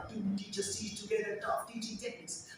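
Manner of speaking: quiet, whispered-off drill; soft tongue twister. Shown as a demonstration. Teacher practising a tongue twister under the breath, soft. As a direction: murmur the twister to yourself. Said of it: "like tongue twisters".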